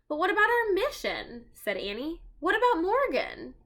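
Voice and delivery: put-on high, pleading little girl; protesting girl voice. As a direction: insist on the mission.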